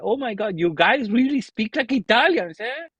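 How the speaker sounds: high-pitched mock Spanish-woman exclamation; imitated Spain accent. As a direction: mimic an amazed Spaniard, singing up high. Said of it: "the accent from Spain".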